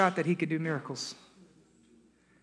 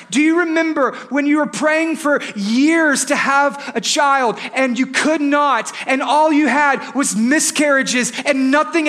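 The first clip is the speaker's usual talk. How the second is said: high, forceful preacher cadence building in intensity; preaching at full force; 9 semitones higher, 8 dB louder than usual. Acted. Pastor reminding himself of God's faithfulness, fervent preaching. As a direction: drive each phrase home with insistent punch.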